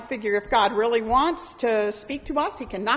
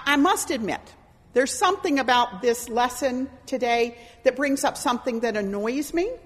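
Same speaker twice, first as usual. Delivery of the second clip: ordinary talk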